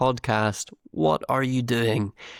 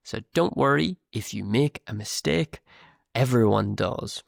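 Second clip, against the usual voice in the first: ordinary talk, nothing marked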